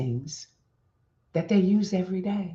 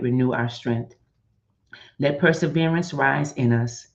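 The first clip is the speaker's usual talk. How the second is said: lower, steady prayerful voice; 4 semitones lower than usual. Acted. To her viewers, leading the closing prayer, calm and steady. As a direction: pray over the community with calm conviction.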